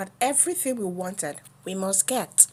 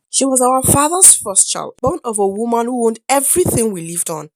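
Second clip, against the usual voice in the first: ordinary delivery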